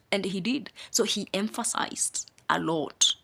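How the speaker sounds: ordinary talk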